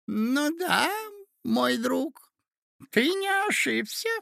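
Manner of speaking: put-on very high-pitched old man's voice; doddering old uncle. A narrator's reading. Old prince unexpectedly agreeing with his nephew, doddering. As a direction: answer in short, high, separated phrases. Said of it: "неожиданно отвечал дядюшка".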